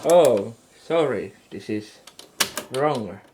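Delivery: ordinary talk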